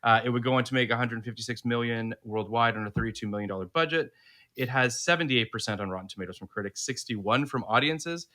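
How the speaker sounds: steady read-aloud of a synopsis; reading a review aloud. Acted. Host to listeners, reading out the film synopsis.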